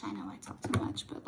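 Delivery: fast whispered admission; whispered aside. Real, not acted. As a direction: murmur it to yourself quickly. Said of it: "so I talk too much".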